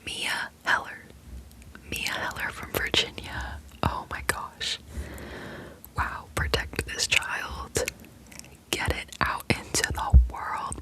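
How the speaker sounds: ordinary delivery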